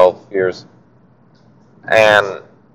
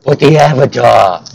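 higher-pitched bad Brooklyn accent; Prince Zuko, Brooklyn accent; 4 semitones higher, 3 dB louder than usual. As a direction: do an urgent cartoon prince in shoddy Brooklynese. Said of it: "kinda giving him a shitty Brooklyn accent".

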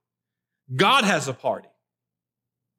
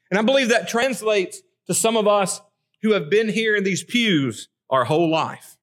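ordinary delivery

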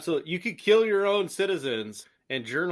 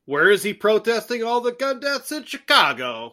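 mock Chicago accent; 3 semitones higher, 3 dB louder than usual. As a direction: lightly do a Chicago "Bears" guy. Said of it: "the Chicago accent for it".

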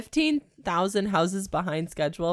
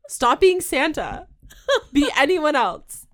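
loud laughing plea; 10 semitones higher, 7 dB louder than usual. Real, not acted. Pleading against Santa scene.

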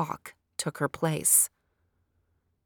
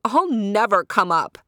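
defiant cry; 3 semitones higher, 7 dB louder than usual. A narrator's reading. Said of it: "cried Sapana".

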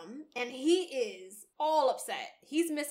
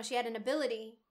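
ordinary talk

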